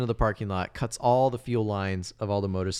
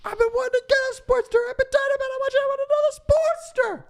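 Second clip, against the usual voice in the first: very high, loud, fast gushing outburst; hammed-up impression bursting at top pitch; Ray Liotta; 5 dB louder, faster than usual. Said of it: "pretty good impression of Ray Liotta".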